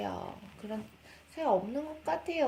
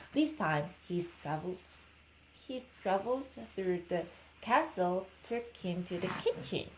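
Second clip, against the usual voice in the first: ordinary delivery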